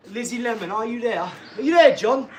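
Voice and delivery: high, rising call that ends loud. Pretend. Streamer hailing absent viewers by name, calling out. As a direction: call out as if searching for them.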